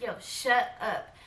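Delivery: slow, stretched words, re-enacted; drawn-out shut up. Showing how the friend was told to shut up, slow and drawn out. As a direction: stretch it out as a firm hush. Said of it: "I told her just like that".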